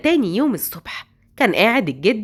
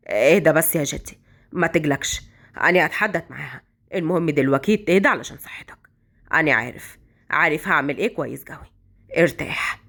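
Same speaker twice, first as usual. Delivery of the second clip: calming grandson; 9 semitones lower than usual. Acted. Jabal to his grandfather, low and soothing. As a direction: settle the old man down gently.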